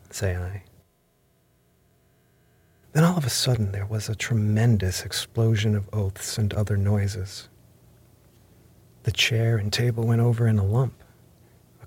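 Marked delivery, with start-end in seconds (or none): none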